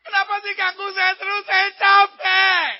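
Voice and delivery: high, loud hammed-up complaint; exasperated, dragged-out plea at high pitch; despairing whine. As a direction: wail it out like a fed-up believer. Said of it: "putus asa dan bilang".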